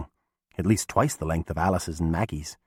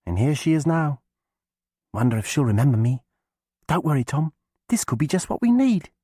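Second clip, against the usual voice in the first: put-on high, bright girl's voice; a sly young witch girl, urging; 8 semitones higher, 4 dB louder than usual. A narrator's reading. Alice speaks to Tom.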